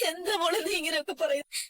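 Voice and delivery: crying, wounded question; weeping reproach, gently put; hurt and tearful. Acted. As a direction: plead through tears. Grandmother hurt by accusation.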